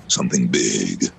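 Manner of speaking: low, ominous promo read; deep trailer narrator building suspense; typical trailer voice. Retold or imitated. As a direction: intone it like a movie trailer. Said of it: "it's, it's typical".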